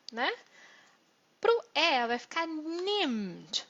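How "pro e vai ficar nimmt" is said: nimmt said slowly with a short I; careful slow nimmt; slow demo of nimmt. Shown as a demonstration. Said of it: "Perceba que ele, o E virou I".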